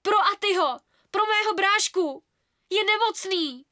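high, loud, anguished plea; girl pleading for her sick brother; desperate plea. A narrator's reading. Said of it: "zoufale jsem bránila".

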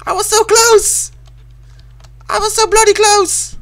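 high, loud wail at a near miss; frustrated screaming. Real, not acted. Fails just before finish.

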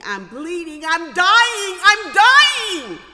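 high, loud panicked wail rising on dying; imitating a screaming child. Mother imitating her bleeding son, hammed-up scream. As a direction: mimic a kid shrieking that he is dying. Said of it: "He's running around screaming".

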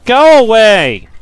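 slow, loud, high yell at the bugs; furious shout. Real, not acted. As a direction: bellow them away in rage. Swarmed by bugs.

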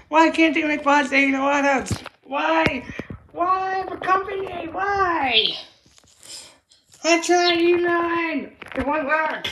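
high, loud exasperated wail; frustrated outburst. Real, not acted. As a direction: plead with the company in real frustration. Kid frustrated with toy company.

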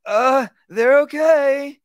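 high, slow, hesitant they're okay; unsure voice. Shown as a demonstration. Wrong way: owner sounding unsure to the dog, high and slow. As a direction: sound doubtful and drawn out. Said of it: "your vocal there is very important".

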